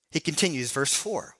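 ordinary talk

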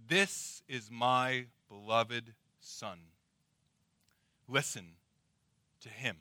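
slow, weighty divine pronouncement; solemn and measured, with long pauses between phrases; voice of God. Acted. God the Father declares his beloved son to frightened disciples, solemn and commanding. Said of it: "that voice sounds and said".

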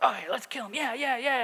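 lightly voiced eager mob; mob egging on. Imitating brothers caught up in mob mentality, mock-eager. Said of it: "the mob mentality, and they're like".

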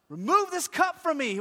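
high shouted plea; crying out. Acted. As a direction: beg God at the top of your voice. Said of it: "he's crying out".

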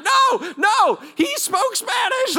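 high, drawn-out mock shout; frantic protest. Visitor to the crowd yelling in tongues, frantic shouted protest. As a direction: shout it slowly over the din.

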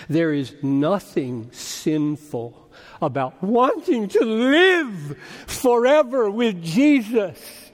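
drawn-out, rising climax; building proclamation. Real, not acted. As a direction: start subdued, then swell into full preaching fervor. Repeats it, building to a cry.

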